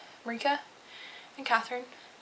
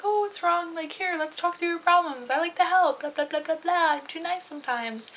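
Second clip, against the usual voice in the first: high, sing-song fake-sweet voice; mock sugary helper; 6 semitones higher than usual. To the guy who says he's sad, mock-sweet. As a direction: play the overly nice friend with a mocking lilt. Mocking her own helpful reply.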